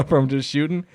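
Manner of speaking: normal talking voice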